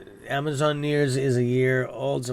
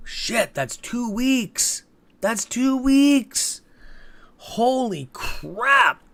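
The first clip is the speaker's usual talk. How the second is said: much higher, slow, drawn-out disbelief; stunned disbelief; 8 semitones higher, much slower than usual. Real, not acted. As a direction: stretch each word out in shock, pitched up. Reacting to two-week transfer delay.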